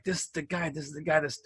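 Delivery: Caesar's quiet fixated mutter; muttered rage. Retold or imitated. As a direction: keep growling it under your breath. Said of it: "He's like".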